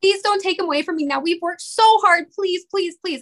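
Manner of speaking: high-pitched, tearful begging; desperate prayer. Retold or imitated. Mother begging God not to take her son, desperately pleading. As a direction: plead with rising urgency, near tears. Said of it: "praying that over and over".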